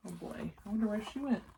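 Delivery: ordinary delivery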